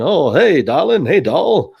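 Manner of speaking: put-on lightly done old Hollywood transatlantic accent; a hint of transatlantic accent drawl. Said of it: "the transatlantic accent".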